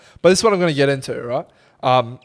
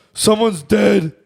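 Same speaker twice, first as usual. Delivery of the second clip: imitating drunk friend; 7 semitones higher, slower than usual. Mimicking drunk friend on FaceTime.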